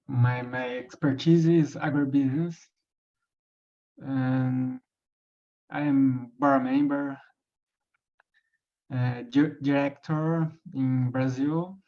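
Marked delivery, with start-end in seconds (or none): none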